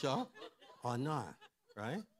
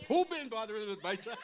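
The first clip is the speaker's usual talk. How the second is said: higher and louder, laughing mock confrontation; fierce mama; 10 semitones higher, 7 dB louder than usual. Mother arriving at school to defend her child, laughing loud mock challenge. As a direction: storm in like a protective mother.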